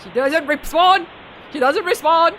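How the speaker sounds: very loud, high mock dramatics; over-the-top dramatic announcement; hammed-up outburst. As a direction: belt it out, overblown and playful. Hammed-up takes before normal reading.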